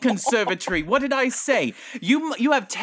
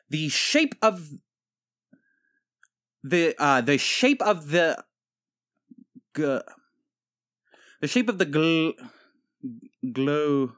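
stumbling, long stops mid-phrase; halting reading; 3 semitones lower than usual. Acted. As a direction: struggle through the words as a poor reader. Stumbles reading one sentence aloud.

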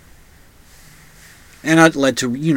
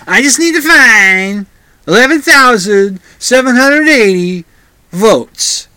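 high, loud mocking Trump voice; Trump impression; 7 semitones higher, 6 dB louder, slower than usual. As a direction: play Trump pleading for votes. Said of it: "That's what he said".

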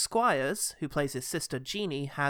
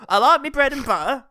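high, very loud cockney performer, laughing; mock slam shout; 10 semitones higher, 14 dB louder than usual. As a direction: belt out the silly line through laughter.

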